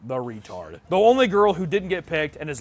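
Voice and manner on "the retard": ordinary talk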